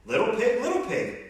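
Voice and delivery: high sing-song call. Acted. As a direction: call politely at the door. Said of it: "so I called".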